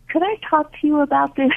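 high, quick hopeful ask; student shyly asking to talk; eager question. Retold or imitated. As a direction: pitch it up and rush it, hopeful. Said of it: "the person just says".

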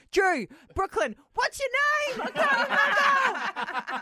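put-on a frazzled mum who can't find the right kid's name; flustered mum. Mum laughing at herself as she mixes up her kids' names, high and flustered. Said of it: "I'll be like".

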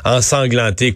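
ordinary delivery

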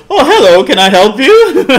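high, loud, sugary welcome; mock polite. To the neighbour at his door, loud mock-polite greeting. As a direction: fake politeness to make him squirm. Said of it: "and go".

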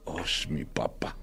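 low whispered "Osh, mi papá"; teenage kid whispering scorn at dad; scornful teen. Retold or imitated. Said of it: "dejas de ser su ídolo a ser".

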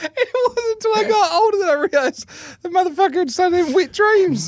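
high-pitched squeal through laughter; cracking up. Real, not acted. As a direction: squeeze the punchline out while cracking up. Cracking up at punchline.

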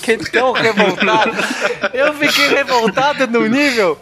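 high, outraged and laughing; indignant, laughing. Real, not acted. Outrage at game show rules.

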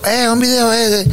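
high, whiny mock fan voice; high nagging fan; pestering fan. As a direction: mimic a fan nagging for a video. Fans pestering at airport.

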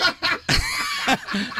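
high squealing laugh. Real, not acted. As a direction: burst into a shrieking laugh. Burst of laughter at joke.

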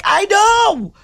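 high, loud exasperated retold yell; re-enacted high-pitched holler; screaming back. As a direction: shout back down the house, fed up. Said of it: "So I scream in the house".

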